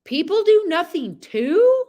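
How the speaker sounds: slowly amazed horse. Pretend. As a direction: voice the horse, stunned. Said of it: "He's like".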